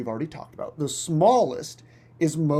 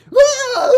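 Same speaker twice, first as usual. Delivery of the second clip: high, loud scream; mock scream; 11 dB louder than usual. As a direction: scream as the claws hit. Scream as bird strikes.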